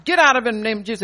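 commanding the devil out, loud and forceful; retold shout. Preacher recalling deliverance on grandson, shouting command. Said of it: "I started shouting".

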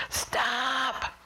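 high-pitched mock yell; retold yell. Parent to noisy kids at night, retold yell. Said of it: "And I yelled really".

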